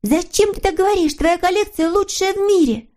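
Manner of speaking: put-on very high, sweet girlish character voice; eager little girl figure. A narrator's reading. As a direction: agree gladly in a high little voice. Snork Maiden protests. (тоненький девичий голосок)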